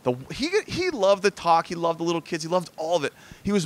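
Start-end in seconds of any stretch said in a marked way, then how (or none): none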